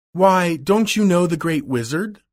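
can't believe he doesn't know; surprised. A narrator's reading. Dorothy asks the scarecrow, lightly surprised. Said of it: "Dorothy asked him in surprise".

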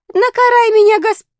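high, loud oath cut short; protesting oath. A narrator's reading. Boy swearing to a suspicious street bully, high-pitched protest.

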